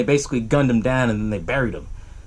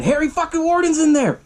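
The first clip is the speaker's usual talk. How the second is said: high-pitched dramatic punchline; spring the villain on listeners, voice jumping up; excited reveal; 13 semitones higher than usual. Real, not acted. Storyteller reveals the killer after the power goes out, excited.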